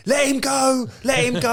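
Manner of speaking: high, shouted; hammed-up manager bellowing from the side; mock sideline shout. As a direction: yell it from the touchline.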